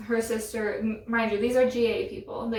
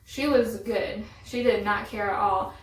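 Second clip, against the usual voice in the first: usual speaking voice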